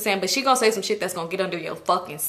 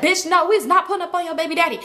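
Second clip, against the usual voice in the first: higher friend voice; 7 semitones higher than usual. Retold or imitated. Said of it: "she said".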